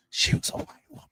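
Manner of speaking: hushed, breathy aside to the audience; whispered aside. Pretend. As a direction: lean in and whisper it like a secret.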